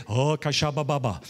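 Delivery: quick babbled tongues; a brief burst of tongues; praying in tongues. Retold or imitated. Praying alone in his room, light tongues. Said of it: "I start to pray in the spirit".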